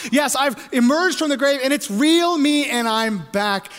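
high, loud, emphatic insistence; full-force, driving first-person declaration; Jesus. Acted. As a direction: preach it as proof of being alive. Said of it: "Jesus tells the disciples".

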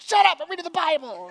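loud, high mock snap; hammed-up irritated outburst; irritated parent. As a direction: yell at the kids in comic exasperation. Acting a parent snapping at kids.

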